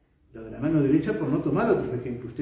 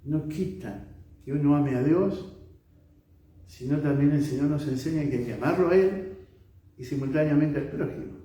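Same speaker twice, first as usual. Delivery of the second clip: normal talking voice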